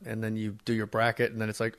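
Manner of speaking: ordinary delivery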